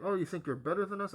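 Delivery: a hammed-up jealous jab at the roommate's guest; mocking sneer. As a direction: toss it out like a sneering accusation. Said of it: "She was like".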